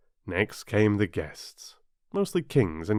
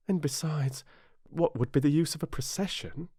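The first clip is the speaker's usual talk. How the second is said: put-on higher-pitched girl's thought, a little soft; Alice's musing; 6 semitones higher, 3 dB quieter than usual. A narrator's reading. Alice puzzling to herself about processions, higher musing voice. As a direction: wonder privately in a higher voice.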